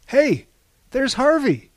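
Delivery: high, loud excited shout. Acted. R T to the others, spotting Harvey, an excited shout. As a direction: spot a friend and yell it out. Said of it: "shouted R T".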